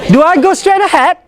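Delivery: "Do I go straight ahead?" lifted high at the end; rising question. Shown as a demonstration. Teacher models a rising question for students. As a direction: let the question climb. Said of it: "You go up".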